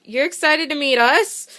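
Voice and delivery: incredulous surprise. Retold or imitated. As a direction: stretch it out, can't believe it. Fans' stunned reply.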